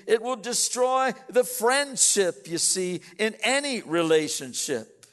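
sermon emphasis building on destroy; preaching. Acted. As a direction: press the point hard to the congregation. Pastor drives home his point.

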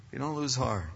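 dropped low and soft, almost to himself; soft echo. Real, not acted. Preacher repeating the line softly to the congregation, hushed. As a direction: let it settle quietly.

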